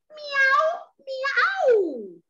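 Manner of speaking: high mewing "Miau, miau"; a cheeky cat meowing twice; cat meows. Acted. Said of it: "el gatito le dijo".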